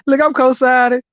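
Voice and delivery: usual speaking voice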